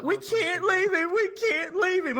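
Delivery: high, far put-on pleading friend voice. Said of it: "my friend Kristen's like".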